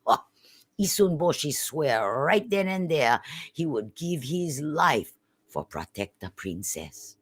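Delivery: ordinary delivery, nothing marked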